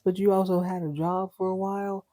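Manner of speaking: ordinary delivery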